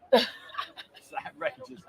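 wheezing, drawn-out laughing words. Real, not acted. Host cracking up over the glasses banter, laughing. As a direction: squeeze the words out through laughter.

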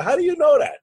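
high-pitched disbelieving question; incredulous. Retold or imitated. Questioning a partner's sure prediction, incredulous. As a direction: question him with the pitch leaping up.

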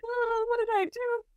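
high, thin panicked squeak; terrified squeak. Retold or imitated. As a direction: squeak it out like you're scared stiff. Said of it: "And I was terrified".

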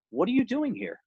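high, startled question; surprised question. Retold or imitated. Recovering addict surprised to find the man in the hole, asking high. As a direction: ask it in real surprise.

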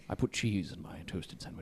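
low, soft sultry whisper; seductive whisper. Pretend. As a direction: whisper it like a film idol. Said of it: "that is almost spot on".

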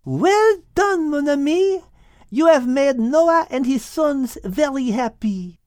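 put-on high voice with a French accent; little French cat, pleased with her friend; French-accented Liz.

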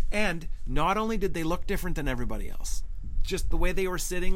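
normal talking voice

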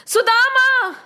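acted loud calling; shouting. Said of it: "and he shouted".

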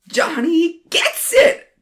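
very high, slow exultant call; cheering. Acted. Ronnie celebrating the New York bit, high exultant cheer. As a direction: cheer like a touchdown.

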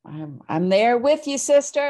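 loud, high burst of agreement; excited agreement. Real, not acted. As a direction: cheer her on like a sister. Enthusiastic agreement with host.

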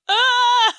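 high, loud startled cry; mock shriek. Narrator voicing Betty's startled face, a high loud cry. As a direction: yelp in shock.

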